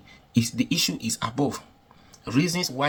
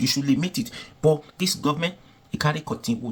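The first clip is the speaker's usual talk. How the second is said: usual speaking voice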